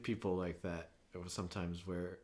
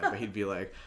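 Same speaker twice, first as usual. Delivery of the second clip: ordinary delivery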